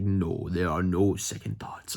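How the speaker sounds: low, slow villain impression; Vader. Apprentice answering the Emperor, imitated deep villain voice. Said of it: "Vader's like".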